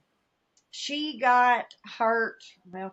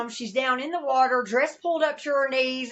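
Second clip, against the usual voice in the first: ordinary talk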